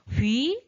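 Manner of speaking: 휙 sweeping up from mid to high; second tone. Shown as a demonstration. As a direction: let 휙 shoot upward like a rising question. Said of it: "이성으로 읽어주시는 거예요". (휙을 위로 확 올리며, 이성으로)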